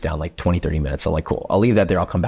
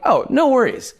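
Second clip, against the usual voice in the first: breezy reassurance. Retold or imitated. Retelling his reassurance to himself.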